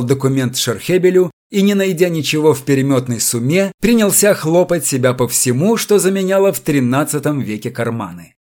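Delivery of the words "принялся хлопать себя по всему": ordinary delivery, nothing marked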